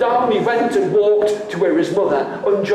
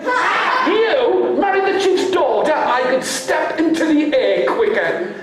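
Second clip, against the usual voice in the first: much higher mocking laugh-talk; mocking scorn; 9 semitones higher than usual. Father mocking his son's plan to marry, high scornful voice. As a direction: scoff at the very idea.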